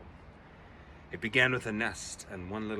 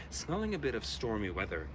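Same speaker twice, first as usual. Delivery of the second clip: ordinary delivery, nothing marked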